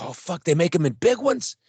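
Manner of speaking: retold astonishment, pitch jumping up; can't believe the good luck; amazed discovery. Retold discovery of big bottles.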